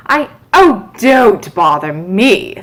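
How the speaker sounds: loud, slow brush-off; the Duchess waving Alice away; irritated Duchess. A narrator's reading. Duchess cutting Alice off, loud and irritated. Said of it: "said the Duchess".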